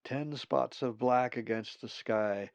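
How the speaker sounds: usual speaking voice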